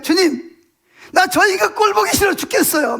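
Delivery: high, exasperated mock shout; yelling to the Lord. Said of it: "막 소리 질러요".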